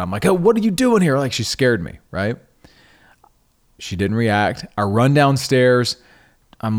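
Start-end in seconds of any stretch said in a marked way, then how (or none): 0.3-1.2 sharp, much higher retold snapping; retold snap. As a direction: snap at her, startled and irritated. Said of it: "snapping at Susanna".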